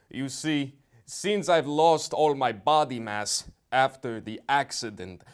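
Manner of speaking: ordinary delivery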